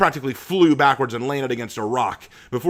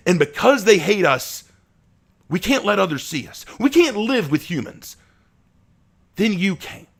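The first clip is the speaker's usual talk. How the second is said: much higher, louder, pleading; impassioned outburst; 10 semitones higher, 6 dB louder than usual. Acted. Asher telling Abraham why the club exists, impassioned. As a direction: pour it out passionately.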